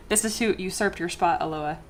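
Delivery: normal talking voice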